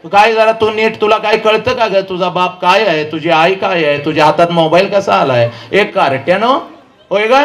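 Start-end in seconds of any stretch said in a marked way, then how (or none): none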